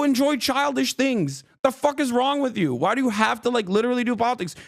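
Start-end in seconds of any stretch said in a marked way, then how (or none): none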